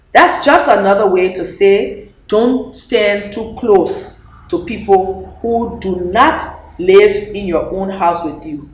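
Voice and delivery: plain reading voice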